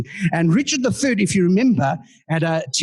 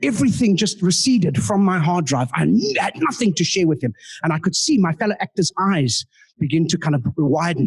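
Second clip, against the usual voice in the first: ordinary talk, nothing marked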